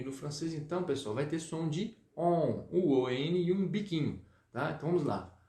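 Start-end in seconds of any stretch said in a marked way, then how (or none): none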